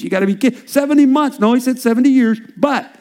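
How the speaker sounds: ordinary talk, nothing marked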